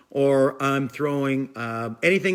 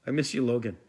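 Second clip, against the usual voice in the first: choked up; 5 semitones lower, 6 dB quieter, faster than usual. Real, not acted. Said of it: "You're gonna make me cry".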